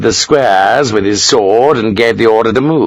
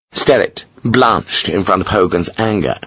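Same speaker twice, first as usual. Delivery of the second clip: ordinary delivery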